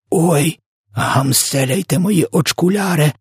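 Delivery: high, drawn-out yell of dismay; wailing cry. A narrator's reading. Giant waving the jar in dismay, high wailing cry. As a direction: wail it high, hammed-up. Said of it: "волав він".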